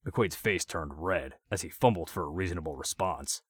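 ordinary delivery

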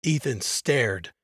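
ordinary delivery, nothing marked

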